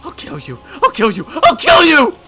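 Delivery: from plain to high, loud scream; rising to a shriek; building threat. Acted. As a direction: escalate with each repeat.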